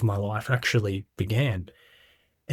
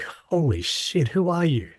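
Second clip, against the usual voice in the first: drawn-out, hushed disbelief rising higher; mild mock astonishment, recalling being taken aback; astonished; 6 semitones higher, slower than usual. Speaker retells meeting an old fella who touched his cheek, astonished.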